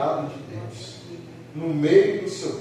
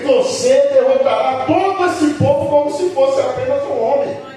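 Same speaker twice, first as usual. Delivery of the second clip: loud, driving preacher's delivery; forceful preaching; 4 semitones higher, 7 dB louder than usual. Real, not acted. Preacher declares he will fear no evil, forceful preaching. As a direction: preach it with mounting force, louder and raised.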